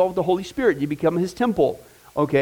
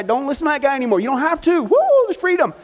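preacher's rising joyful exclamation; excited whoop; 7 semitones higher than usual. Real, not acted. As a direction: build to a whoop of celebration. Preacher exulting over freedom.

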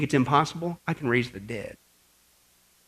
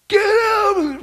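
high, loud yell of alarm; urgent warning shout; 6 dB louder than usual. Acted. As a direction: yell to save someone from falling. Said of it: "What do you do?".

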